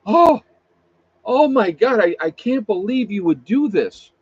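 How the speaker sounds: high, loud mock outrage easing off; hammed-up shocked protest; playing the victim. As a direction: gasp it out like a falsely accused victim. Mocks caught liar playing victim.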